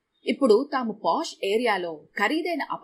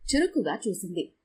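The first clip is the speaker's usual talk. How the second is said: usual speaking voice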